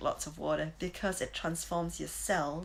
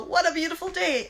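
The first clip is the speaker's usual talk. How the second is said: much higher and louder, beaming; joyful exclamation; 11 semitones higher, 7 dB louder than usual. Retold or imitated. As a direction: shout it to the morning with delight. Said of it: "I'll go".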